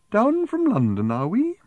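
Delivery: high, maddeningly drawn-out prim voice; slow, prim. A narrator's reading. Woman quizzing Arthur, infuriatingly slow. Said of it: "wouldn't talk so bloody slowly".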